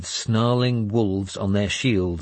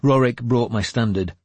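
ordinary delivery

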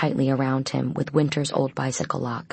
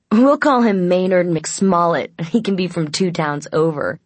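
higher, louder, amused invention; snickering; 4 semitones higher, 6 dB louder than usual. A narrator's reading. Aiden invents an alias for Gabriel, snickering. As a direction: draw out the silly name with a mocking lilt.

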